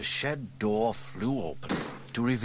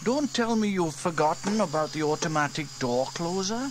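put-on high boy voice, unsure; young boy James shrugging it off; 10 semitones higher, 6 dB louder than usual. A narrator's reading. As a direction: voice the young brother. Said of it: "said James".

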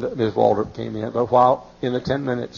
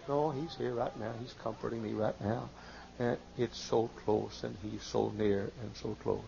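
much softer, calm assurance; quiet sincere comfort; tender reassurance; 11 dB quieter than usual. Real, not acted. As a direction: drop to a gentle hush of comfort. Soft contrast after the shout.

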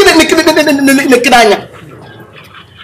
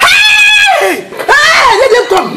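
shouting; much slower than usual. Acted. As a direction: call out after them defiantly. Keeps shouting after the men.

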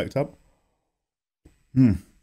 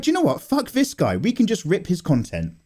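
mocking the company; 6 semitones higher than usual. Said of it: "they're just like".

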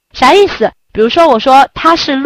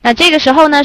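usual speaking voice

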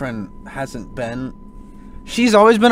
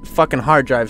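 usual speaking voice

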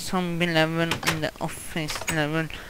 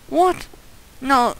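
high, loud yelps of disbelief; short stunned outbursts; shocked disbelief; 8 semitones higher, 8 dB louder than usual. Real, not acted. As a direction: blurt it out, high and incredulous. Disbelief after the game mishap.

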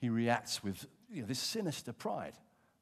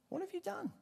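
much higher, shocked repeat; incredulous cry; 4 dB quieter than usual. Retold or imitated. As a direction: shoot the question up in disbelief. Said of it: "Samuel comes along and he says".

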